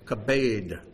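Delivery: Kabed as kah-BAYD, falling at the end; Hebrew word kabed. Shown as a demonstration. Preacher modelling a word for the congregation to repeat, Kabed as kah-BAYD. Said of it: "Can you say kabed?".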